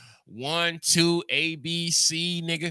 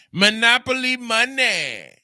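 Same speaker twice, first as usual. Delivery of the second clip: higher and louder insistence; emphatic loud repeat; 7 semitones higher, 7 dB louder than usual. Shown as a demonstration. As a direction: hammer it home louder.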